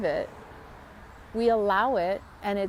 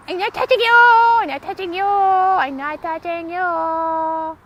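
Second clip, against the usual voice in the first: high singsong taunting; teasing sibling; 8 semitones higher, 8 dB louder than usual. Retold or imitated. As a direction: drag out the taunt. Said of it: "My sisters and I would be like".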